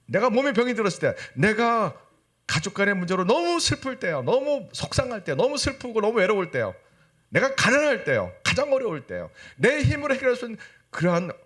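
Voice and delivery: high, insistent sermon list; impassioned preaching. Acted. Preacher lists hardships that test faith, impassioned preaching. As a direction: drive each hardship home on a high pitch.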